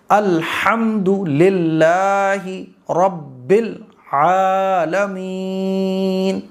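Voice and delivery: very slow, drawn-out melodic recitation; slow qirat style. Shown as a demonstration. Teacher showing the correct slow qirat. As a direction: recite with stretched, careful qirat. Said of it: "ایسے".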